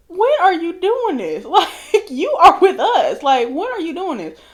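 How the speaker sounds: high, laughing questions to a friend; giggling half-shouted disbelief. Retold or imitated. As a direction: ask it through laughter, can't believe it. Friends shocked, questioning Africa laughing.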